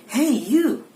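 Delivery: "you" called out high and loud; stress on you. Shown as a demonstration. Presenter showing word stress to listeners, loud stressed call on "you". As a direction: hit "you" hard, like calling someone across a room. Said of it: "when I say you, that is the one of stressing".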